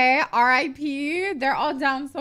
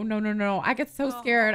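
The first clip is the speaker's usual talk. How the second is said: normal talking voice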